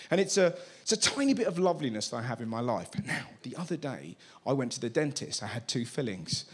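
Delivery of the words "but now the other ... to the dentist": ordinary talk, nothing marked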